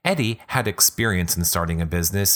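usual speaking voice

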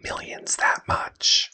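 breathy hushed aside; whisper. Real, not acted. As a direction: say it in a secret whisper. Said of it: "whispered aside".

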